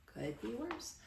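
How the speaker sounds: soft, unbothered grandpa reply; softly voiced old grandpa; deadpan grandpa. A narrator's reading. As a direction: shrug it off quietly.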